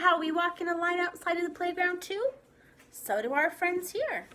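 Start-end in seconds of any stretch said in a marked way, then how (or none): none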